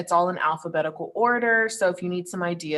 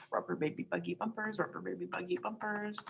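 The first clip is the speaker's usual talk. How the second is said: quiet tongue twister; 11 dB quieter than usual. Shown as a demonstration. Teacher showing students a warm-up tongue twister, quietly. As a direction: say it softly, almost to yourself.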